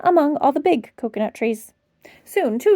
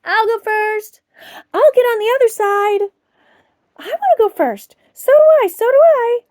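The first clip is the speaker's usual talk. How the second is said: put-on higher, louder children's clamour; eager shouts; 8 semitones higher, 6 dB louder than usual. Children squabbling over the seesaw, excited. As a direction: clamour to go first. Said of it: "shouting with excitement".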